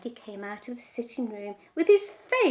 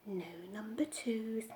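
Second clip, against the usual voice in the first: much softer; boy sulking under his breath, softer; sulky mutter; 3 semitones lower, 11 dB quieter than usual. A narrator's reading. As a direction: grumble to himself. Boy grumbles.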